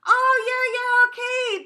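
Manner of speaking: high, loud syrupy sweet voice; sugary sweet. Retold or imitated. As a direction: gush sweetly to the teacher. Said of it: "B sweet is punched to the instructor".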